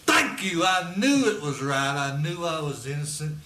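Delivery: excited. Real, not acted. Cleared after lie test.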